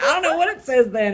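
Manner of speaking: high, loud, laughing panic; panicked high wail with a laugh; mock panic. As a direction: squeal it like you're caught out. Panicked self-quote continues.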